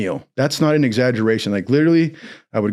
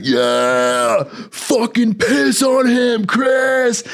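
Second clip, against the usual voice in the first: crowd cheer; 10 semitones higher, much slower than usual. Retold or imitated. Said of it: "everybody's like".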